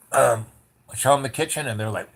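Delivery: ordinary delivery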